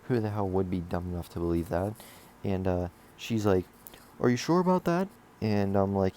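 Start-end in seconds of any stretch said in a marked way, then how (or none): 4.2-5.1 higher-pitched doubtful question; skeptical questioning. Retold or imitated. Teacher questioning a student's excuse, skeptical and higher-pitched.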